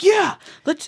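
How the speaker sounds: high, hyped imitation; amped-up friend. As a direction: egg them on as the hype friend. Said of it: "feel more amped".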